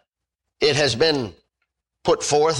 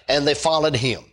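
normal talking voice

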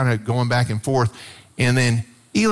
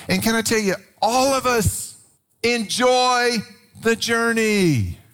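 raised preaching emphasis; 9 semitones higher than usual. Real, not acted. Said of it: "preacher lifts for emphasis".

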